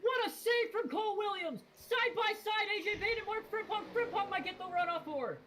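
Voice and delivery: very high-pitched, breakneck excitement; thrilled race call. Real, not acted. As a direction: yell the play-by-play at fever pitch. Commentator calls save and side-by-side battle.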